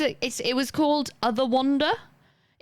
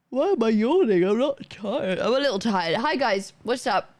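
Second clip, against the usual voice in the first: usual speaking voice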